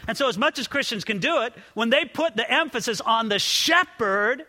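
ordinary talk, nothing marked

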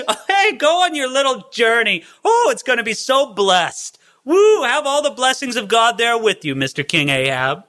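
high, gushing mock blessing, dropping on the title; sarcastic. Prophet wishing the king a blessed journey, sarcastic. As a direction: send him off with exaggerated false cheer. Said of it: "he was being sarcastic".

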